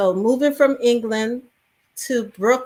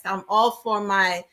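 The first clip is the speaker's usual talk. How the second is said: ordinary delivery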